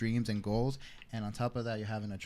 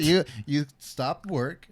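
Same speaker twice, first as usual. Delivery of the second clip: slow loud indignant protest; 2 semitones higher, 6 dB louder, slower than usual. Retold or imitated. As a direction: spell out the outrage. Indignant retelling.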